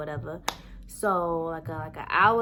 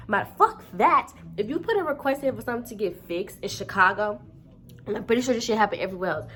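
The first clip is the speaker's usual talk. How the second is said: heated rant; 4 semitones higher, 5 dB louder than usual. Real, not acted. Ranting at apartment office.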